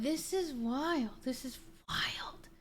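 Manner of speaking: slow, hushed murmur of disbelief; slow, quiet, stunned murmur. Retold or imitated. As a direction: breathe it out in awe. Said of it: "we're like".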